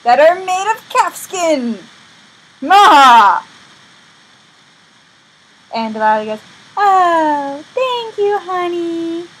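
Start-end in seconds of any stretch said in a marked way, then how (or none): 0.0-3.4 high, slow line ending in a loud cackle-like cry; hammed-up character voice. Boastful villainous line in retold story. 6.8-9.3 high, sugary mock thanks; sweet-talking, hammed-up lover; put-on Delilah. Delilah thanks Samson, acted.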